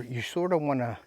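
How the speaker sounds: ordinary talk, nothing marked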